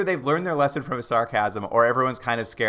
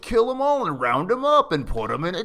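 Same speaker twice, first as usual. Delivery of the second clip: higher, mock-sarcastic cop quote; retelling a cop mocking protesters, sarcastic and higher; 6 semitones higher than usual. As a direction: imitate him sneering the purists' idea back at them. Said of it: "with sarcastic tone".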